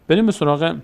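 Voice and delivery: ordinary delivery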